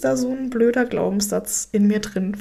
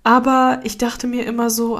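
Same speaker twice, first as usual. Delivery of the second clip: ordinary talk, nothing marked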